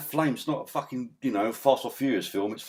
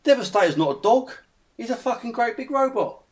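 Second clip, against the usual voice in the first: pitched-up outraged rant about the robot dog; exasperated rant; 10 semitones higher than usual. Real, not acted. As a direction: rant at the bad design in disbelief. Ranting about Devastator design.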